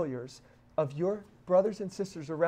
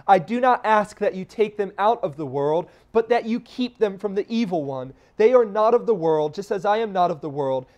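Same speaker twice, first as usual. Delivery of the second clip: loud fervent pulpit reading; louder, ringing preacher's read of Christ's prayer; preaching Christ's prayer; 2 semitones higher, 7 dB louder than usual. Acted. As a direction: proclaim it to the congregation, louder. Preaches scripture at full force.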